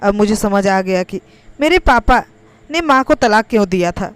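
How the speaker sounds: ordinary delivery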